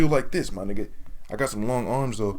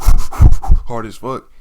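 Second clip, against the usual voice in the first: laughing; 16 dB louder, much slower than usual. Real, not acted.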